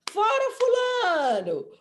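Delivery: high, drawn-out mock crowd chant; grito de torcida. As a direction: shout it slowly like a football fan. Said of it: "começa a gritar". (gritando como torcida)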